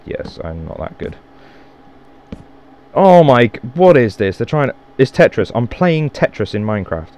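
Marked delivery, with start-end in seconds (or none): 0.0-1.2 whispered admission under the breath; soft whispered self-mockery; whispered aside. Real, not acted. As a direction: confess it in a whisper.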